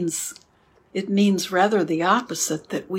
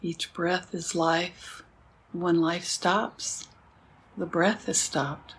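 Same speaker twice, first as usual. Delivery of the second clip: soft spa whisper; 7 dB quieter than usual. Acted. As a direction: ease them into stillness. Slow meditation cues.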